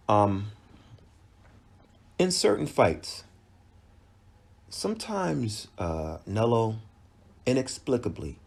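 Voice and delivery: ordinary talk, nothing marked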